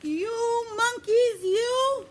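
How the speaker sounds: high, loud, slow scold; slow, loud, high-pitched telling-off; angry scolding. Acted. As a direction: scold the monkeys, shaking a finger. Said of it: "very angry".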